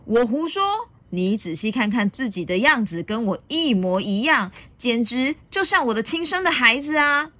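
mildly hammed-up dinosaur retort; arguing Tyrannosaurus. A narrator's reading. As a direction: throw the accusation back, insistently. Tyrannosaurus argues back forcefully.

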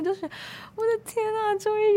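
high, bright-eyed gasp of delight; a sudden high cry of relief; overjoyed exclamation. Retold or imitated. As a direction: squeal it out like you're finally understood. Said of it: "我都会突然分感动".

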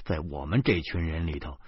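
ordinary delivery

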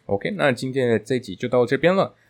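ordinary talk, nothing marked

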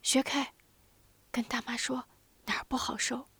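put-on aunt whispering to the sick boy on the bed; whispered. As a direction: lean in and whisper gently. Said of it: "小声问".

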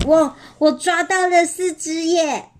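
lifted, thrilled voice; excited. Acted. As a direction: announce the catch with storybook delight. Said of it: "比尔兴奋地说".

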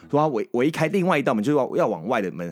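normal talking voice

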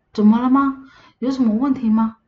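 surprised question. Retold or imitated. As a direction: ask as if baffled. Aunt puzzled by child's shock. (惊讶地追问)

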